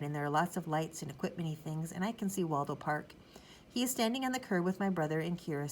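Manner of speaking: ordinary delivery, nothing marked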